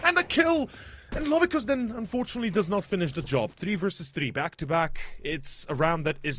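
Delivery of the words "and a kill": high, loud hype call; hyped shout. Real, not acted. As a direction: scream the highlight like a caster. Caster hypes triple headshot.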